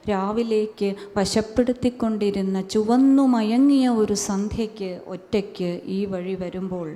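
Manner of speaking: measured, atmospheric recitation; poetry recital. Acted. Poet reciting her ghost poem, hushed measured recital. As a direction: draw the listener down the dusk road.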